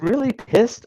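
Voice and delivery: scoffing air-quote voice; incredulous mock. Shown as a demonstration. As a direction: treat the phrase as absurd. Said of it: "a technical term?".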